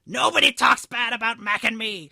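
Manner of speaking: high, loud crone screech; old lady's scream. A narrator's reading. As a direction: scream it as a furious old woman. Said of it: "she screamed".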